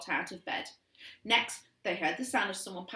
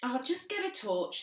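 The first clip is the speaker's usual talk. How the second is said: sighed; 6 semitones higher than usual. A narrator's reading. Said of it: "sighed Dad".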